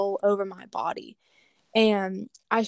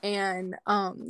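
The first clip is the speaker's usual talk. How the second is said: normal talking voice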